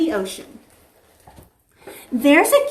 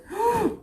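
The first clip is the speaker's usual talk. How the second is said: mock gasp; 9 semitones higher, 6 dB quieter than usual. Alarm at noon.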